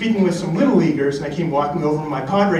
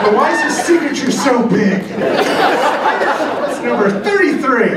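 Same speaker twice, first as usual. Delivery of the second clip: high, emphatic explaining dad; imagined father; 9 semitones higher, 3 dB louder than usual. Retold or imitated. Father explaining baseball signatures to son, high incredulous dad impression. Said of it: "his father as he's trying to explain".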